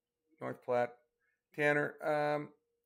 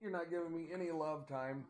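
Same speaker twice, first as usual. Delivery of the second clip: higher put-on dog voice; playful pet voice, higher; voicing the dog; 5 semitones higher, 4 dB quieter than usual. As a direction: speak for the whining dog, a little hurt. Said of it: "She's like".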